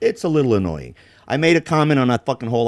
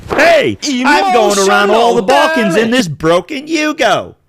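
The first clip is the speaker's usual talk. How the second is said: high, loud mock vlogger voice; hammed-up upbeat travel host; imitating the American vlogger; 10 semitones higher, 6 dB louder than usual. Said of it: "he goes".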